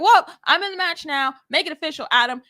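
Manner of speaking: high, loud cocky exclaim; excited quote. Retold or imitated. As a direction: brag it at the boss. Rhea quoted grabbing the match.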